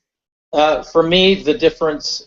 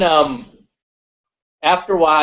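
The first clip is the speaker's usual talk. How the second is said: normal talking voice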